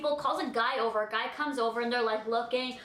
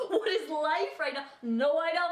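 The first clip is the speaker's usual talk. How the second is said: disbelief; 3 semitones higher than usual. Retold or imitated. Disbelief at airport.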